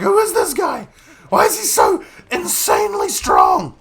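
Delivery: high-pitched mock awe; baffled enemy fellas. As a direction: imitate stunned foes marveling at the hero. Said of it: "These fellas must be like".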